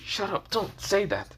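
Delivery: high-pitched, drawn-out bashful protest; flustered, blushing. A narrator's reading. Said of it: "kind of blushes and says".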